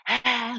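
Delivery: short open-mouthed breath out; quick huff with tongue out; lion's breath exhale. Shown as a demonstration. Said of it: "lion's breath".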